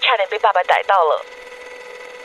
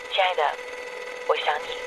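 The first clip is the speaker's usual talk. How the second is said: tender; 5 semitones lower, 8 dB quieter than usual. Acted. Tender voicemail to lover.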